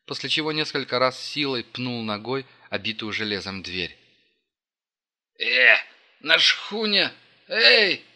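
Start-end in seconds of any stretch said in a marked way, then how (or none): 5.4-8.0 loud high yell; yelling in a strange voice. A narrator's reading. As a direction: bellow it out, unlike yourself. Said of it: "заорал Алекс не своим голосом".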